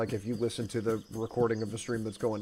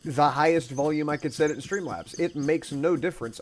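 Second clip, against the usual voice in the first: ordinary delivery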